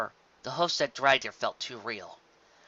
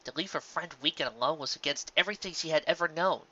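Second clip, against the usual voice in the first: ordinary delivery, nothing marked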